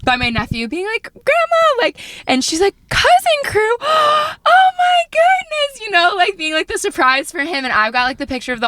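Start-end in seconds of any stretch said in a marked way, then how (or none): 1.1-1.8 put-on high-pitched child's call; playful little-kid shout-out; imitated excited kid. Nephew bombarding grandma at the door. 2.9-5.8 high, gasping mom reaction; drawn-out, high-pitched amazement; imitated delighted surprise. Voicing mom discovering the surprise.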